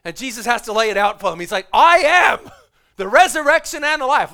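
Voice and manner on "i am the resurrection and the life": Jesus's words, shouted high and loud; booming proclamation. Retold or imitated. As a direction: proclaim it full voice, as if it could wake the dead. Jesus proclaims, sermon climax.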